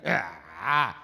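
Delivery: demonstrated monster-like "ah"; exaggerated scooping monster "ah". Shown as a demonstration. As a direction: dip "ah" down and back up like a monster. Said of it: "I call it the monster voice".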